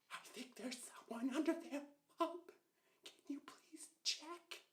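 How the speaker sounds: put-on high, hushed little-girl voice; imitating a frightened little sister; timid child pleading. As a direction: plead in a small, scared near-whisper.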